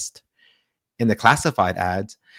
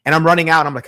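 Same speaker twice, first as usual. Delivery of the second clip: usual speaking voice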